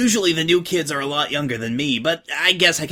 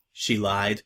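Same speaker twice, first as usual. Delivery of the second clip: usual speaking voice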